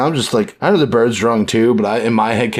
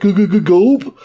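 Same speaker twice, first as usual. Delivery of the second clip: higher, slow, stuttering gulp of fear; cartoon terrified stammer; 12 semitones higher, 4 dB louder, much slower than usual. Townsfolk cowering as they name the feared doctor, stuttering.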